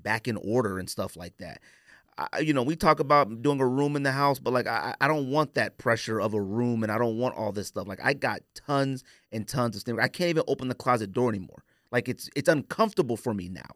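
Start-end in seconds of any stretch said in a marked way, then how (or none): none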